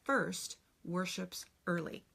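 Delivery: slow, deliberate recital; slow "Her church first worships early"; slow teaching sentence. Shown as a demonstration. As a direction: spell it out slowly for kids. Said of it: "the sentence is".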